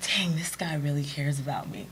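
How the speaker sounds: soft whisper of private amazement; whispered thought. Retold or imitated. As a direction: breathe it like a secret to yourself. Inner thought told as a whisper.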